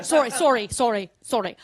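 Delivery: "sorry" alternating open SAR-ee and rounded SOR-ee; Canadian-American sorry contrast. Shown as a demonstration. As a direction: switch the vowel of "sorry" between AH and OH. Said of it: "if you listen, you'll hear it".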